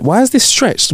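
imitating himself staring at the screen; mock confusion. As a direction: ask it like the video looks wrong. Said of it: "I was, I was like".

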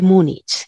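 "Múnich" ending in a Spanish ch. Real, not acted. Linguist back on topic, "Múnich" with final ch. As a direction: say "Múnich" with ch as in chico. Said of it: "yo digo Múnich con che".

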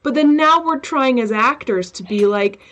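normal talking voice

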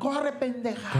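high slow urging cheer; gambler urging. Pretend. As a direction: egg the horse on, drawn out.

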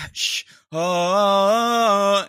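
shush, then long loud high "oh"; hush, then launch into a held mock note; imitated shush and singing. Host imitates singer shushing her and resuming, loud held note. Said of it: "And then he goes".

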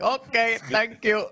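mock Indian accent, laughing; Apu impression. Co-host mocking the host's thank you, mock Apu accent. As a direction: imitate the shopkeeper's thank you, giggling. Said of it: "sounded like you might have been a poo from the Quickie Mart".

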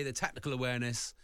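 ordinary talk

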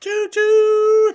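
very high, loud train whistle call; train whistle choo choo. Pretend. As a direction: shout it like a toy train whistle. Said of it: "That was probably quite loud in your ears".

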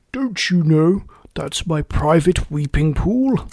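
slow, mildly angry beast; a touch of slow, drawn-out indignation; deep, angry voice. A narrator's reading. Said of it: "said a deep, angry voice".

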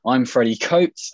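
ordinary talk

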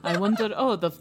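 feigned obliviousness. Acted. Suitor deliberately turns his back for her, feigned obliviousness. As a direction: pretend loudly not to notice, amused. GM acts Zayden pretending not to notice.

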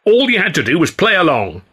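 high defensive protest. A narrator's reading. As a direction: argue back hard. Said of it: "Ed protested".

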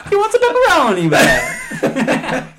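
high, loud hammed-up Italian accent; a pizza-shop Italian psychic, hammed up. Doing Miss Cleo as Italian, high loud hammed-up accent. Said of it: "she was Italian".

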